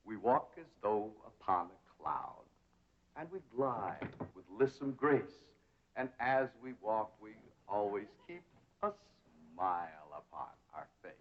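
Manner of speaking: soft, measured recitation with pauses; recited posture verse. Shown as a demonstration. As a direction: recite it softly, gliding from phrase to phrase. Posture teacher recites while demonstrating gliding.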